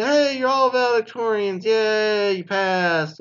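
high, sarcastic cheer; fake-celebrate with a sneer; mock cheer. School mockingly cheering every graduate, high and sarcastic.

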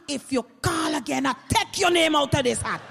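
loud indignant threat to a bill collector; threatening shout. Acted. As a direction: shout it like a final warning. Debtor threatens the debt collector.

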